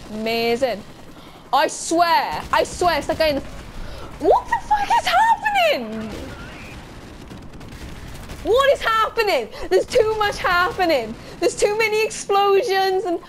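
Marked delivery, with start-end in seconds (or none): 4.2-6.1 extremely high-pitched baffled shriek; panicked squeal. Real, not acted. Overwhelmed by explosions in game. 8.4-13.2 high, overwhelmed wail; flustered exclaiming. Real, not acted. Overwhelmed by explosions in game.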